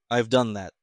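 ordinary delivery, nothing marked

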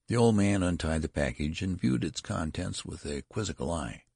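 ordinary delivery, nothing marked

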